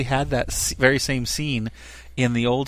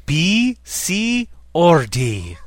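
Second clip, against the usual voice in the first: put-on Scottish accent; 5 semitones higher, 6 dB louder, much slower than usual. Fan quoting Scotty's line.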